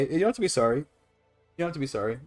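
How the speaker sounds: normal talking voice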